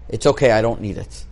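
low, soft, understated retold answer; quiet calm reply. Rabbi quietly declining his speech from the gabbai, calm and low. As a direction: retell the reply softly, low in pitch.

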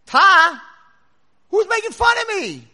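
high, very loud indignant yell; acted scream. Kid to his unseen mocker, yelling. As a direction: shout it out across a canyon. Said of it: "this kid screams across the cavern".

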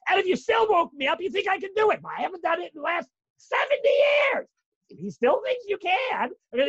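high, loud pleading to God; a preacher acting out a grateful prayer, high and loud; impassioned plea. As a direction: plead it out with full fervor. Voicing a man pleading with God.

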